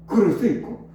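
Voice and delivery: ordinary talk, nothing marked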